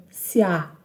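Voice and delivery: "se há" run together as one syllable, sya; joined syllables. Shown as a demonstration. Teacher showing two syllables joining, "se há" said as one. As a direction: glide "se" straight into "há" without a break. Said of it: "essas duas sílabas se juntam".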